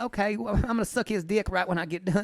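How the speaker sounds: imitating mama. Quoting his mama's reaction to the Southern manager, high and loud. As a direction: squeal it like his excited mom. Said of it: "my mama said".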